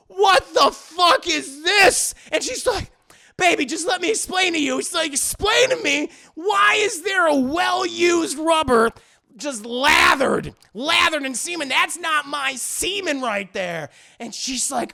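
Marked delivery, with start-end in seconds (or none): 0.1-2.1 slow, high, furious mock shout; hammed-up jealous outrage; outraged demand. Man discovers used rubber, outraged. 3.4-4.8 pleading. Retold or imitated. Woman pleads to explain. 5.1-13.9 loud, high, slow mock rage; hammed-up shouted accusation; furious interrogation. Man furiously demands explanation.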